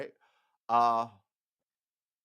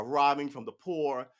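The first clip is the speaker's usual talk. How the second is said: ordinary talk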